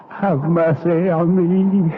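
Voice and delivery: begging through tears, rising to a cry; sobbing cry begging the demon to hear him; crying plea for mercy. Acted. Doomed monk begs for mercy.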